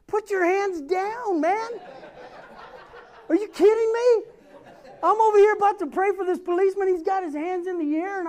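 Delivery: half-laughing, can't believe it; laughing disbelief. Retold or imitated.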